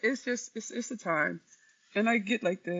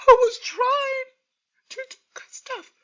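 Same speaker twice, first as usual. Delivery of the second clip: high, slow, breaking into sobs; the words crack and then collapse into tears; real crying; 13 semitones higher, 7 dB louder than usual. Real, not acted. Grieving streamer asking God why, crying. Grieving a friend's sudden death.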